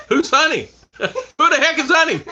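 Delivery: mock the wife's outrage while laughing. Imitating the wife's jealous question, laughing. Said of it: "She's still laughing about the honey".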